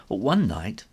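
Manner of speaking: usual speaking voice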